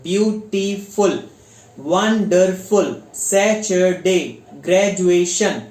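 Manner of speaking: ब्यूटीफुल said slowly, each syllable drawn apart; slow syllable demo. Shown as a demonstration. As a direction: stretch each syllable out for the class. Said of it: "three syllabic stress words क्या होते हैं?".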